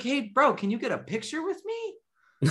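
joking excitement. Retold or imitated. Showing off to the brother, hammed-up giddy boast. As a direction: ham it up like a thrilled star. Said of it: "I was like".

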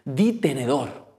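slow, insistent schoolkid command; lightly voiced pushy classmate urging; a hint of the nervous friend from school. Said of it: "súper nervioso".

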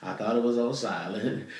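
normal talking voice

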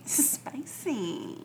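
drawn-out whispery echo; playful whisper. Pretend. Echoing the cohost's teasing, drawn-out whisper. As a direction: stretch the word out in a half whisper.

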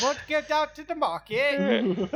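put-on jokey New Zealand accent showing how it should be. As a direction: play a chirpy New Zealander asking a mate out. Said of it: "New Zealand is more like this".

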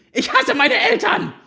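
put-on high teen girl voice, yelling; teenage tantrum. A narrator's reading. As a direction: shout like a sulky teenager.

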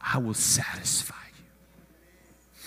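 slow, whispered promise; hushed scripture. Real, not acted. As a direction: let the verse drop to a whisper. Scripture quoted in hushed emotion.

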